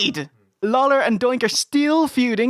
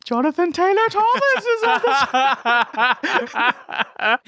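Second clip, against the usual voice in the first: slow mock wail; Paul Bearer impression; 5 semitones higher, slower than usual. Host mocking Paul Bearer, slow laughing wail. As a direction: draw it out slowly like a manager.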